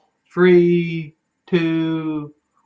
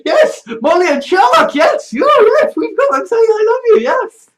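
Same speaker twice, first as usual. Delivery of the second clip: gushing excited; 4 dB louder than usual. Retold or imitated. Mock fan-pleasing show voice.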